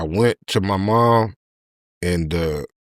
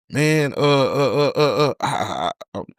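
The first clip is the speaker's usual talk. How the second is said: nervous stammer; 3 semitones higher than usual. Retold or imitated. Own nervous stammer, retold.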